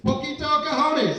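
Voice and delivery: high, stretched-out toast cry; rousing toast. Retold or imitated. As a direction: call out a toast like a bartender. Bartender toasts with tequila.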